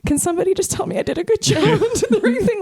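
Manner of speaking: high, half-laughing whiny plea; mock-pitiful ask for reassurance; pleading for praise. Shaken student begging for praise, high whiny plea. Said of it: "I was also just like".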